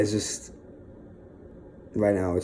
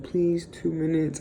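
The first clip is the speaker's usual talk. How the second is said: higher, slow, coaxing mock plea; a playful imitation of a girlfriend begging for more time; imitating her pleading; 7 semitones higher, 3 dB quieter, slower than usual. Boyfriend imitating girlfriend begging for two more minutes, mock pleading. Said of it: "She's like".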